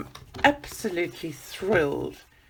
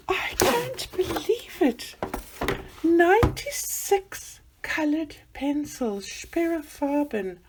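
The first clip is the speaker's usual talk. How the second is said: high, delighted exclamation; real excited wonder; thrilled disbelief; 8 semitones higher than usual. Real, not acted. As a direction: marvel at the gift. Counting the gift.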